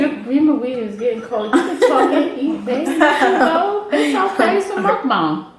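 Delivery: high, laughing tease; playful teasing. Real, not acted. Teasing her child at dinner.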